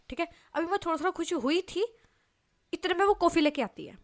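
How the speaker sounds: ordinary delivery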